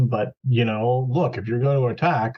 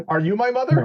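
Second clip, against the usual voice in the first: high, plaintive little creature; childlike creature voice; 8 semitones higher than usual. Acted. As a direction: ask it like a lost baby bird. Player jokes in creature voice.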